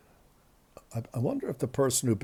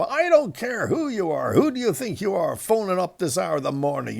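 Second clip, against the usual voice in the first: louder and much higher, an imitated furious shout; mock angry yell; 7 semitones higher, 4 dB louder than usual. Sleepy man woken at night yells at the radio host, mock furious. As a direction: play the woken-up man yelling down the line. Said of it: "He starts screaming down the phone".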